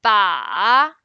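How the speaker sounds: long, loud dipping "ba"; third tone. Shown as a demonstration. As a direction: draw "ba" down and back up. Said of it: "And the third one".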